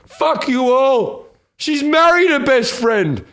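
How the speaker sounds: put-on high, loud, slow drunken wail; hammed-up drunk crying out at his mates; mock tearful drunken yell; drunken tearful outburst. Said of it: "breaks down in tears in a drunken stupor".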